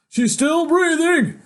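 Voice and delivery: very high, loud, slow announcement; relieved voice. Acted. A rescuer announcing she's breathing, high and slow. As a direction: someone calling out that she lives. Voice in story.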